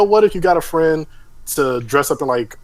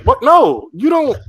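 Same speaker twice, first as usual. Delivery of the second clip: high, loud incredulous yelp; mock disbelief; 5 dB louder, slower than usual. Reacting to a fake prank with a high, loud disbelieving yelp. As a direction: squeak out no in disbelief.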